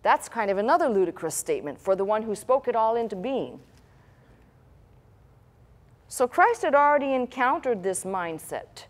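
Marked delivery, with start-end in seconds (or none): none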